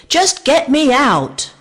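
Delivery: high loud scream. A narrator's reading. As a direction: scream impatiently. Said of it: "screamed Vic".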